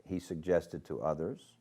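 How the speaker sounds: ordinary delivery, nothing marked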